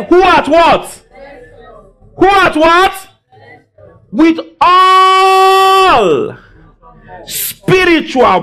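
high, ringing call to the congregation; preacher's rousing call. Real, not acted. Preacher prompting the church to read the verse, rousing high call. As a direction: draw out ALL in a high cry.

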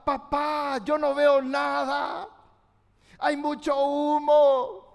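put-on frightened boy shouting from the smoke, slow; frightened boy calling. Son calls from the burning window to his father, frightened. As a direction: cry out scared, trapped and blind.

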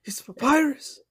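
SNL Papyrus bit. Retold or imitated. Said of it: "Ed's referencing the classic S N L bit".

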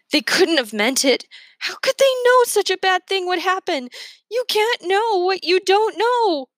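high, distraught pleading; forced-out protest. A narrator's reading. Protesting to the officer that they didn't mean it, distraught. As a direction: push the words out, climbing to a high cry. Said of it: "I push the words out of my throat".

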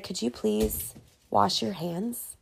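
soft, slow prissy customer voice; hushed and dainty, mocking the diners; mock polite request. Server mocking customers asking her to wash hands, hushed. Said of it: "Now it's like".

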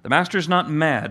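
ordinary delivery

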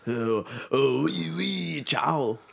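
lightly hammed-up French and Italian accent; mock French Italian accent. Host mocking a French and Italian car merger in a half-hearted French-Italian accent. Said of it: "fix your accents".

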